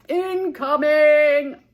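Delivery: long, loud drawn-out call; yelled. Acted. Grandpa warning Frank from the porch, a long loud yell. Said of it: "he yelled".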